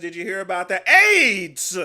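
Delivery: put-on voice, called out loudly; a neighbour hollering the news; loud mock gossip. Comic voicing a gossiping stranger in a loud put-on voice. Acting out people asking.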